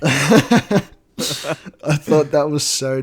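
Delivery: giggling through the words, high; laughing. Real, not acted. Friends laughing about the ringtone scene. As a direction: laugh as you admit it. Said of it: "laughing while both say it".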